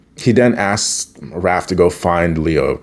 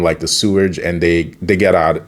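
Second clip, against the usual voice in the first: usual speaking voice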